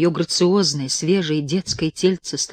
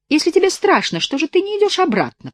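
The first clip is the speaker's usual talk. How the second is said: put-on high, bewitching little girl; girl's eerie voice; 14 semitones higher, 3 dB louder than usual. A narrator's reading. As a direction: lure him like a wicked child. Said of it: "прозвучал голос Семины". (высокий колдовской голос девочки)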